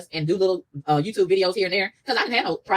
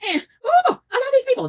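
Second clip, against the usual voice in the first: high, loud sarcastic gush; mock-loving sarcastic cry; 5 dB louder than usual. Real, not acted. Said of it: "sarcastic exclamation".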